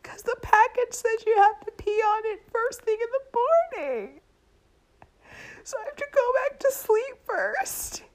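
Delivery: very high-pitched tearful wail; hammed-up sobbing whine; mock crying. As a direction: bawl the explanation like a weepy wife. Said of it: "now you're crying".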